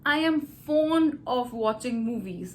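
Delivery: slow, clear demo answer; slow deliberate example answer; model answer. Shown as a demonstration. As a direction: spell out the model reply slowly. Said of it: "Now how will you answer it?".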